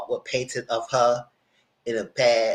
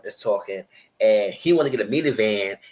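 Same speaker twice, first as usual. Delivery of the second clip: ordinary talk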